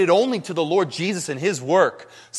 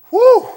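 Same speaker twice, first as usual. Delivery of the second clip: whew; 14 dB louder than usual. Real, not acted. After counting all chapters.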